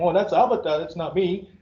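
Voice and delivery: usual speaking voice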